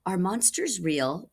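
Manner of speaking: ordinary delivery, nothing marked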